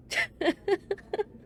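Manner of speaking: high, giggling laugh. Acted. Laughing at a smutty book title, high giggle. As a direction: burst into high, short giggles.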